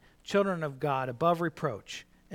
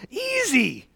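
mock breezy; 10 semitones higher, 8 dB louder, slower than usual. Mocking how easy the verse seems.

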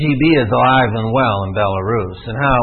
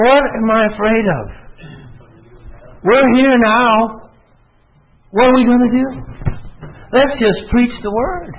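defiant resolve; 11 semitones higher than usual. Retold or imitated. Resolving his fear aloud.

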